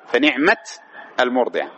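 "فنعمة" stopped on a vowelless t, fa-ni'mat, then a break; underlying still ending. Shown as a demonstration. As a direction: halt on the t of "فنعمة" before "المرضعة". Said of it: "أصلها". ("فنعمة" بتاء ساكنة ثم وقفة)

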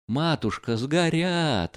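Лебедев wails, pitched high; a high despairing wail over the money. A narrator's reading. Lebedev wails as the money burns, pitched high. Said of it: "вопил Лебедев".

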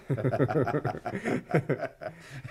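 long amused laughter; a long hearty chuckle. Acted. Both laughing at the likeness.

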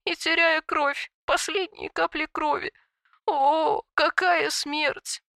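high, slow wailing lament. A narrator's reading. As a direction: moan it out in grief. Weeping dragon wails on.

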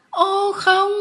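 very high, loud cry of protest; alarmed outcry. A narrator's reading. Remy stopping the lady writing to his parents, loud outcry. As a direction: burst out in alarm. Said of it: "tôi kêu lên".

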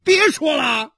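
high, loud explosive yell; furious shout. Acted. Husband silences his wife before slapping her, shouting. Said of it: "许三观喊着".

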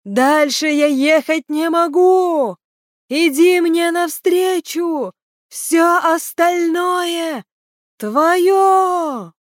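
high, loud, very drawn-out call; shouting. A narrator's reading. Киран tells Леслия he can go no further, shouting. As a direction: shout it slowly across a distance, stretching the vowels. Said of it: "закричал Киран".

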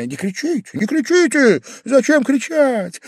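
flustered Pavel Pavlovich. A narrator's reading. Guest begging the host not to shout, high and flustered. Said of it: "торопливо замахал рукой Павел Павлович".

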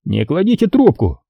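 high-pitched order to hold; sergeant's voice. A narrator's reading. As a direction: stop the caller in a high official voice. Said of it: "сказал он".

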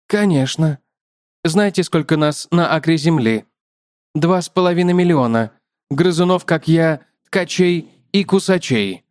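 chatty spider, lifted above the narration; talkative spider. Acted.

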